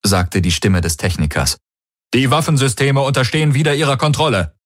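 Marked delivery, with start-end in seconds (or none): 2.1-4.6 higher, quick report from a technician; a lightly voiced eager subordinate. Technician reporting to Ylana, higher quick voice.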